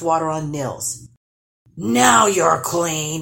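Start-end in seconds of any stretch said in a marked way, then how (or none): 1.7-3.2 troll declaring. Acted. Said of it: "he declared".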